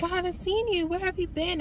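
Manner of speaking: high, bright greeting, imitating the barista; friendly barista. Starbucks lady greeting a regular, imitated high and friendly. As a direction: lift your voice into a cheerful hello. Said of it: "She was like".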